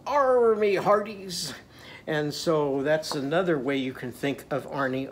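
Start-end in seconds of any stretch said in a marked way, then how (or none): 0.1-1.5 slow, high pirate call. Acted. Teacher imitates pirates for children, slow pirate call. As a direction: draw it out like a pirate hailing the crew. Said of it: "And sometimes they go".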